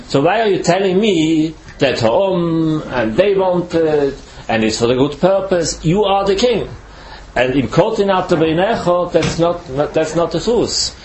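usual speaking voice

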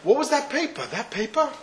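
mother's voice jumping up in alarm; shocked mother. Acted. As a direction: gasp out the question as the startled mother. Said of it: "and says".

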